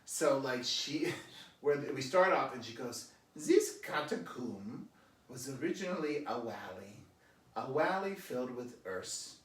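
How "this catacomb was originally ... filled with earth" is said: imitating a German-Italian guide's mangled English; mock German-accented tour guide. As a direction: stress the wrong syllables in a thick German accent. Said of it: "she had the wrong accent on all the syllables".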